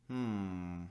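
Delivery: ordinary delivery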